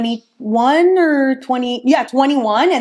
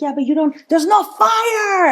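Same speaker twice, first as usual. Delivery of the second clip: imitating a man pleading with her; retold shout; 9 semitones higher than usual. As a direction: stretch out the words and shout them. Boyfriend-to-be arguing retold.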